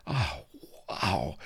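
hushed, awed little gasps; softly awestruck; reverent gasp. A narrator's reading. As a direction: breathe it out in awe, with a pause. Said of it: "gasped Mistress Bonny reverently".

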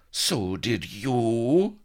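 high, drawn-out wail; wild cry. A narrator's reading. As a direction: cry out wildly, very slowly. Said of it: "cried Vanity wildly".

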